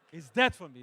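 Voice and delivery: loud, emphatic repeat; shouted. Acted. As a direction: bellow the words out. Deadpan then shouted repeat.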